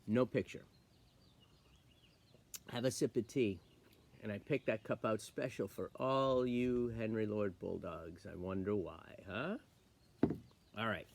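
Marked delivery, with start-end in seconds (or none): none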